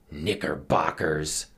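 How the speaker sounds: slow, low-key grumble; frowning disdain. A narrator's reading. Captain eyeing his mixed crew, frowning disdain. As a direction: say it slowly with a frown. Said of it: "he just frowned and said".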